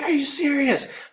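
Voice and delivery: high, drawn-out incredulous question; re-enacted astonishment; disbelief. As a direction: stretch it out in high-pitched amazement. Reacts to an unlikely coincidence.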